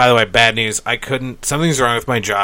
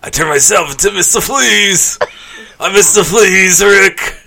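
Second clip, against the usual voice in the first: Pickle Rick parody; 8 semitones higher, 5 dB louder than usual. Pretend. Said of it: "Now you're turning into Pickle Rick".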